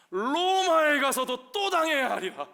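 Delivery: slow, high, loud proclamation; booming decree. Acted. The Lord telling Paul to suffer again, loud drawn-out decree. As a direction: stretch it out at full preaching force. God's command rephrased as grim decree.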